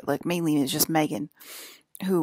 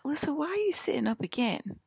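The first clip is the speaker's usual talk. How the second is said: higher-pitched mimicked question; puzzled questioning; 9 semitones higher than usual. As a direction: imitate someone asking, pitched up in puzzlement. Acting out others' puzzled question.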